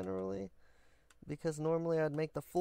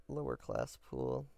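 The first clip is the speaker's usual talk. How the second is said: deep, slow "lower class pool"; 5 dB quieter, slower than usual. Acted. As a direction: drop way down for it. Dropped voice aside.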